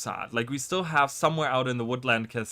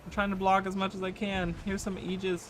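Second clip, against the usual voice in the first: being chased; 7 semitones higher than usual. Real, not acted. Still fleeing in combat.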